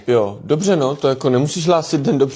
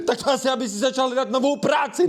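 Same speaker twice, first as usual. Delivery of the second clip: scornful shout; 7 semitones higher, faster than usual. Acted. Dad yelling at the son who asks for a car, scornful. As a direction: yell at the son.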